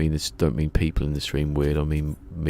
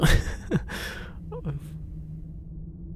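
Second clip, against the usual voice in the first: airy high laugh; 12 semitones higher, 4 dB quieter than usual. Real, not acted. Laughs at confusion.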